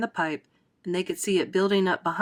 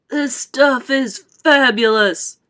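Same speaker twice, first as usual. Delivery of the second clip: slow, loud, higher relish; greedy boy; 5 semitones higher, 7 dB louder, much slower than usual. A narrator's reading. Boy gorging on river chocolate, slow relish. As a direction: savour it slowly and loudly.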